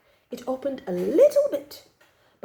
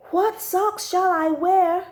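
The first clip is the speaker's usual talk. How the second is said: put-on child asking; 8 semitones higher, 4 dB louder, slower than usual. A narrator's reading. Child narrator voices own question.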